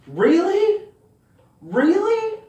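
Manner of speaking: very high, loud squeal of disbelief; incredulous. Acted. Incredulous reaction to mom's question.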